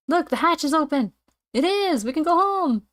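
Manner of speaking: high-pitched, thrilled exclamation; excited cry. Acted. Students spotting the open hatch, excited. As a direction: cry out in high, eager relief.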